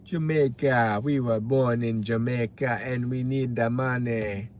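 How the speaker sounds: mock Jamaican accent, badly faked; hammy attempt at a Jamaican accent. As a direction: put on a rough Jamaican accent for a joke. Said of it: "that was a bad Jamaican accent".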